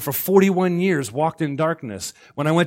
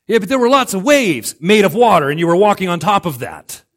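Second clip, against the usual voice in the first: loud, higher, mock incredulous comeback; exasperated arguing; 4 semitones higher, 8 dB louder than usual. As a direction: argue back emphatically. Acted argument between Peter and Jesus.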